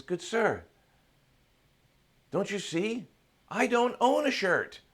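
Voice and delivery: high, loud, building exclamation; exclaiming revelation. Acted. Happiest man reveals the truth to the seeker, exclaiming. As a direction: build to a high, ringing punchline.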